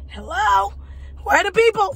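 high, loud mock call for anyone around. Retelling arriving at the wrong house, calling out. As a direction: re-enact hollering into an empty place.

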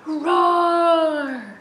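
loud roaring lion. Shown as a demonstration. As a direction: roar like a big lion. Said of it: "Let me hear your biggest roar".

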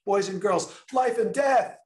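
high excited shout. Retold or imitated. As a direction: shout it out like a kid. Kids shouting out opposites.

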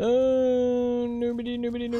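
silly mocking singsong; teasing singsong. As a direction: tease in a high singsong. Teasing game character.

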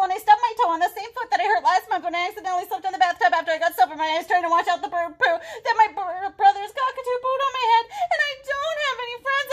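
high, loud, breakneck wail of complaints; hammed-up upset kid, climbing to a wail; tearful child's rant. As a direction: pour out every woe in one rush. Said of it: "explain why he's crying".

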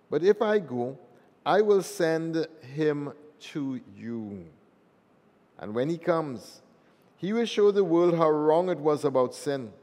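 loud gospel reading in a reverberant church; cathedral echo. Acted. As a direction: proclaim it to the whole nave. Gospel read aloud in church.

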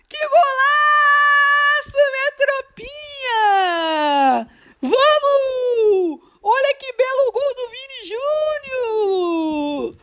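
high, slow, drawn-out joyful cry; explosive goal commentary. Real, not acted. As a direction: scream the goal in delight. Goal celebration, crying out. (grito de gol eufórico)

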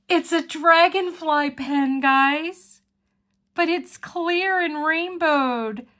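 real delight, slowed on the last words; excited delight. Real, not acted. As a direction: gush over the gift. Opening a gift pen.

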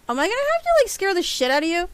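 high, loud mock threat; playful loud teasing. Real, not acted. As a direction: tease the pet with a big voice.

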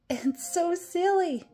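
usual speaking voice

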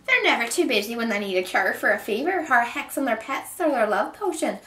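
high, loud witch's tirade; shrill storybook witch complaining; shrieking witch. A narrator's reading. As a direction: rant in an outraged witch screech. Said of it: "the witch shrieked at Kate".

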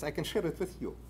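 ordinary talk, nothing marked

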